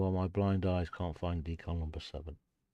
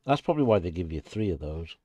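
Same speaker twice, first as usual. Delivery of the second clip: ordinary delivery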